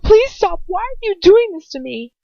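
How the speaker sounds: high, loud terrified begging; desperate pleading. A narrator's reading. Captive begging Bellatrix to stop, screaming in fear. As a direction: plead through terror and pain.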